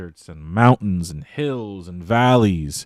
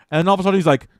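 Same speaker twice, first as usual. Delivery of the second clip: ordinary delivery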